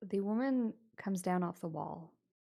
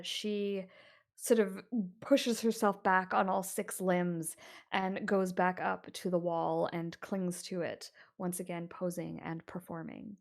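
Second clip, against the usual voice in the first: ordinary delivery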